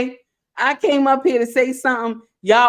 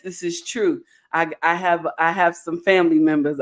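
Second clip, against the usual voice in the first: ordinary talk, nothing marked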